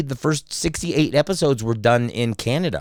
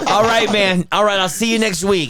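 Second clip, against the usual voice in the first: high-pitched, hearty retold goodbye; upbeat phone sign-off; 9 semitones higher than usual. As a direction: wrap up the call still buzzing. Friend signing off excited phone call.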